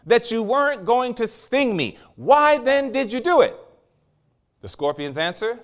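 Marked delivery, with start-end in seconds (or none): 0.1-3.6 pained outcry. Acted. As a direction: cry out like a betrayed, dying frog, voice raised high. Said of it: "The frog is screaming in pain".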